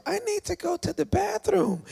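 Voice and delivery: high-pitched whiny excuse; mock pleading. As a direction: imitate a squirmy churchgoer in a high voice. Mimics wriggling listener wanting out.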